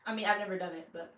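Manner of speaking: ordinary talk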